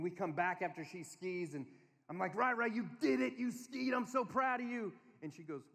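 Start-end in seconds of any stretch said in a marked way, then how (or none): 2.3-4.9 high, excited praise; acted burst of proud excitement; proud parent. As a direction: cheer on a little child, imitating a delighted dad.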